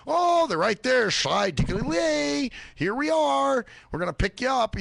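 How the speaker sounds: hammed-up sing-song pickup call; mock driver voice. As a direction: parody the cheerful drivers. Mocking drivers arriving on time.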